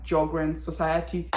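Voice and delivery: ordinary talk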